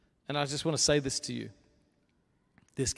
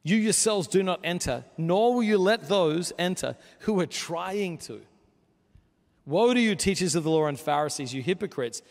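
loud, raised preaching voice driving home the rebuke; impassioned sermon climax; full-force preaching; 4 semitones higher, 8 dB louder than usual. Acted. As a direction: preach it hard to the whole room.

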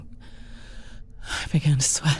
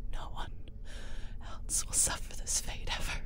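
soft resolute whisper; whispered vow; 13 dB quieter than usual. Acted. Woman vows before swallowing the poison, whispering. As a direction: breathe it out, barely voiced. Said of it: "I whispered".

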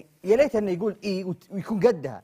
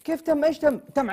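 higher, louder demanding; exasperated; 9 semitones higher, 6 dB louder than usual. Acted. Yasser pressing Mitab in raised disbelief. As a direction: demand what he means. Arguing over the dessert.

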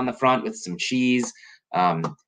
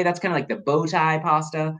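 ordinary delivery, nothing marked

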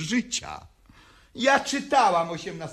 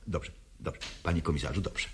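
soft, much quieter whisper of giving in; whispered placating; 9 semitones lower, 11 dB quieter, much faster than usual. Acted. Woman whispering agreement to the police commissioner. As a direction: breathe it out to soothe him.

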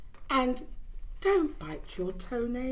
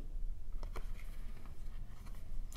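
gasp; 11 dB quieter than usual. Acted. Reading a baby book aloud.

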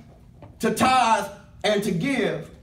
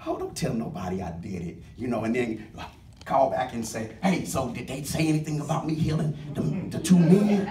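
much lower, soft and quick, sly fishing voice; mock gossip; 9 semitones lower, 5 dB quieter than usual. Imagined Jesus checking if they talked, mock sly. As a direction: play a sly braggart fishing for praise. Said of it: "He's not saying it because".

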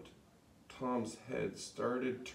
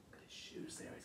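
whisper; 17 dB quieter, faster than usual. Real, not acted. Hushed aside about the picture.

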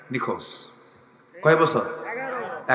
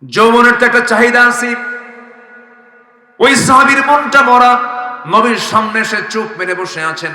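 full-voice preaching; 9 semitones higher, 11 dB louder than usual. Acted. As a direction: preach it out loudly on a raised, sustained pitch. Preaches in a loud chant.

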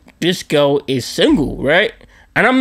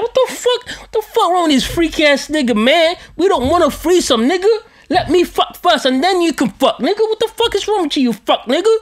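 much higher, laughing outrage; pissed-off high rant; 11 semitones higher than usual. Retold or imitated. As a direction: squeal the complaint in mock fury, half laughing. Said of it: "So I get pissed off".

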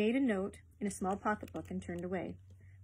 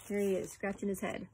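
ordinary delivery, nothing marked

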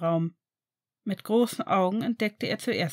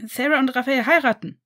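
higher, louder blurted news; 5 semitones higher, 7 dB louder than usual. A narrator's reading. Sam blurting out the wedding news, loud and high. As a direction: blurt it out, can't hold it in. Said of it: "platzte es aus Sam heraus".

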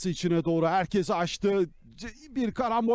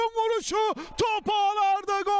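screaming football commentator; 13 semitones higher than usual. Real, not acted. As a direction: shout the goal at the top of your voice. Goal scored, commentator calls it.

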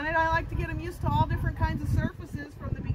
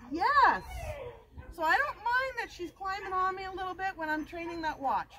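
ordinary talk

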